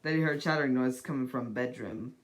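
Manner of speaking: usual speaking voice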